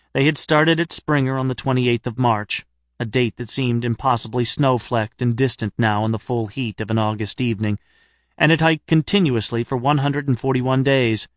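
plain reading voice

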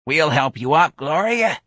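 high-pitched feeble lady's voice; dotty old woman. Acted. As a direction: offer help in an old woman's treble. Miss Trixie's character voice.

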